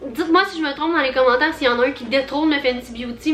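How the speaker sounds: ordinary talk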